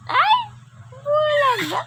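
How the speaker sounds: high, loud playful peekaboo squeal; sudden sing-out surprise for a child. Pretend.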